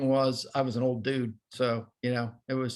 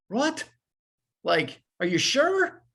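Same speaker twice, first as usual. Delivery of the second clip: retold surprise; 10 semitones higher, slower than usual. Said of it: "and he's going".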